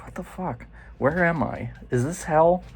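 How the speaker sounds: quietly appalled, re-enacting disbelief; mock bewilderment. Patient hearing screams in his new room, mock bewildered.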